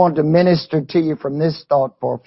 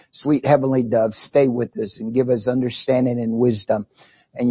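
prayer; 6 semitones lower than usual. Acted. Opening prayer to God.

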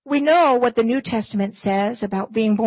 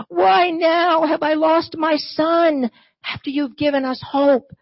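high, slowed, anguished questioning fading to a hushed why; grieving anger; 6 semitones higher than usual. Acted. Grieving mother questions God, anger sinking into hushed grief. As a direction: pour out her hurt at God, then let it die away. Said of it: "I can only imagine the anger and hurt and grief".

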